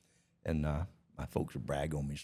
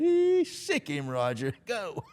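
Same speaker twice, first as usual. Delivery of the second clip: shrill high cheering yell; whooping carnival crowd; 12 semitones higher, 4 dB louder, much slower than usual. Retold or imitated. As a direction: egg him on like a rowdy crowd. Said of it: "the crowd yelled".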